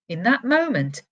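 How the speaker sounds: ordinary delivery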